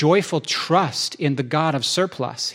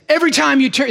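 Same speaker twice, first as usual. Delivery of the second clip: high, loud rock shout over the band; singer's shout; 12 semitones higher, 8 dB louder, faster than usual. Retold or imitated. Preacher imitates [name] shouting over the band. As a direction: belt it like a metal singer on stage. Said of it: "And he says".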